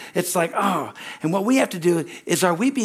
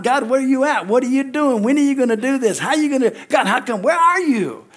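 whining complainer; 8 semitones higher, 4 dB louder than usual. Retold or imitated. Voices complainer demanding God.

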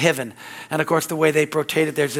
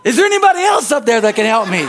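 high, loud, hurried holler; hammed-up panicky call for someone else; yelling up; 6 semitones higher, 6 dB louder, faster than usual. Man on the branch seeking other help, panicky yell.

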